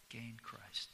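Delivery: hushed repeat to let it sink in; soft reflective echo of the verse; quiet echo. Shown as a demonstration. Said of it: "emphasized certain words".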